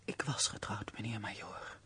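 low, soft, half-whispered admission; hushed sorrow. Acted. Widow answering the major's question about marriage, hushed and sorrowful. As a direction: confess it very quietly, as a painful memory.

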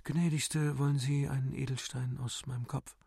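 softly modest request. A narrator's reading. Böhm offers a lady a gem from his head, modest. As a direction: offer it gently, with humility. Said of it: "Bescheiden sprach er".

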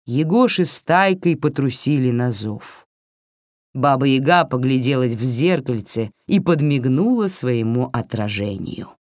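plain reading voice

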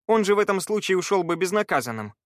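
understated indignation in a narrated quote; indignant objection. A narrator's reading. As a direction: protest with a touch of outrage. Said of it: "возмутился Скай".

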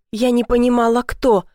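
usual speaking voice

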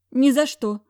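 high, loud frantic cry; panicked refusal. Acted. Heroine screams refusal at boss. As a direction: refuse in terror.

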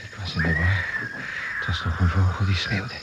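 low, hushed self-reassurance; dazed muttering. Acted. Crash survivor tells self it was only a bird, muttering. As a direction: talk yourself down, softly.

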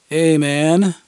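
a hint of a cocky teen; young guy voice. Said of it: "young male voice countered".

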